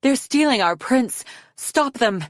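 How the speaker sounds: high, urgent shout to the guards; shouted alarm. A narrator's reading. Guard calling others to stop the kidnappers, shouting. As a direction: raise the alarm, pitched high. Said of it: "The one that was shouting".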